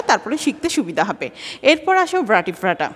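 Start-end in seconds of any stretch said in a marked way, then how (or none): none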